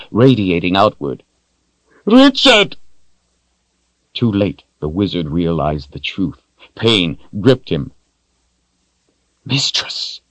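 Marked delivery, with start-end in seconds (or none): 2.0-2.8 sudden high cry of the name; a sharp, high anguished shout. Acted. Wizard crying out Richard's name, anguished high shout. 9.5-10.2 soft unvoiced whisper. Wizard kneeling before his mistress, whispered. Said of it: "he whispered".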